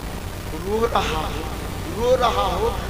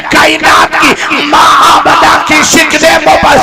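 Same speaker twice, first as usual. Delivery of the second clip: loud, slightly higher fervent sermon; full-force preaching; 5 semitones higher, 13 dB louder than usual. Acted. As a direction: proclaim the miracle to the crowd. (पूरे जोश से ऊँची आवाज़ में तक़रीर)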